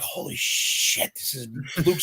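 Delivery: starstruck, slow, breathless whisper; awed whisper. Retold or imitated. Fan meets Luke Skywalker, awed slow whisper. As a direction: whisper it in awe, slowly. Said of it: "I literally turned to jello".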